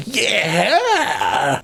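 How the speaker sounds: a hint of a leering creep; the severed head gloating over Megan; creepy leer.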